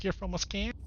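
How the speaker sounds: lightly mocked Wisconsin accent, high and soft; softly hammed-up Wisconsin accent; imitated Wisconsin accent. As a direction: quote it with a touch of Midwest twang. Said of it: "I could tell from the accent".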